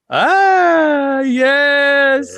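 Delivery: flattered painter. Acted. Monet flattered.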